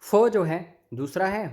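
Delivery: normal talking voice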